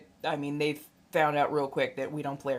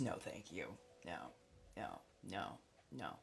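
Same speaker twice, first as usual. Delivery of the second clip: much lower and softer, no thank you fading out; quiet refusal; 10 semitones lower, 19 dB quieter than usual. Acted. To kids hitting on her, a low soft no no. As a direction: brush it off under your breath.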